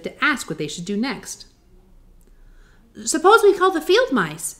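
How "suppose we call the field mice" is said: put-on girl voice. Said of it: "she suggested".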